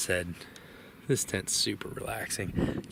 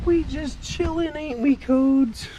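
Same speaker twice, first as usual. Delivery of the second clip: talking to pet; 6 dB louder, slower than usual. Real, not acted. Talking to the dog.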